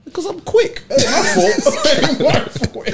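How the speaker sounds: high, loud cackling comeback; squealed through laughter; laughing boast. Real, not acted. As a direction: crack up while owning up cheekily. Laughing boast after being accused.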